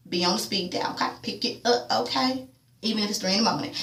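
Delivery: hushed, coaxing request to a friend; soft quoted plea. Retold or imitated. Friend asking to be called anytime, quiet and soft. Tasha voiced softly, phone request.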